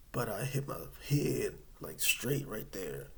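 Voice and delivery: usual speaking voice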